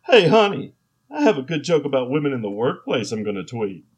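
eager loud announcement; proud and pleased with himself; excited. Acted. Acted husband line.

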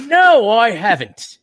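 high, slow, loud British reply; exaggerated British denial; mock British. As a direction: draw it out as a hammed-up English gent. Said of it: "none of us have British accents".

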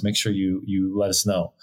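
ordinary talk, nothing marked

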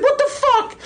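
very high what the fuck; squeaky startled yelp; shocked yelp. Retold or imitated. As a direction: get slapped out of nowhere. Friend slapped, retold.

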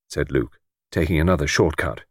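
usual speaking voice

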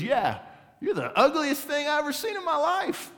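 very high, loud parrot taunt; hammed-up high parrot voice; insulting parrot squawk. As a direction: screech the insult like a brazen parrot. Parrot repeats insult in joke.

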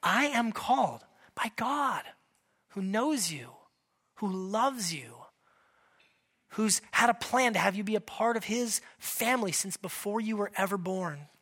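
slow and soft, like a vow; slow, solemn declaration. Shown as a demonstration. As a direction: say it slowly and softly as a confession. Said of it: "Let's say it".

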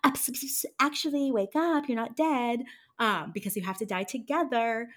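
a light mock-cheery wake-up call; playful Verna. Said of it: "Verna was like".

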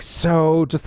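normal talking voice

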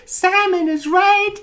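very high, loud impression; [name]. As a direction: squeal it as the pop star.

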